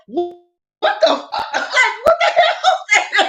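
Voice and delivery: very high, slow, laughing disbelief; laughing shock. Retold or imitated. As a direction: shriek through laughter. Man sets the woman on fire.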